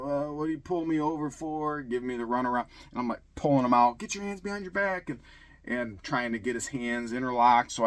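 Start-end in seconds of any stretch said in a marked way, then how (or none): 0.0-1.8 lightly voiced high driver, pitched up; a hint of a stoned driver. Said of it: "high as all get out". 4.0-5.1 high, urgent command without full volume; mock arrest command, pitched up; retold police order. Acted order during arrest.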